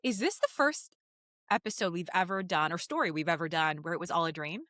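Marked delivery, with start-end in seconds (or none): none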